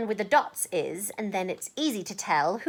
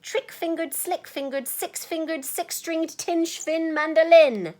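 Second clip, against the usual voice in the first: higher and building louder; building excitement; 7 semitones higher, 4 dB louder than usual. Acted. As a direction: build up to a big finish. Tongue twister building to climax.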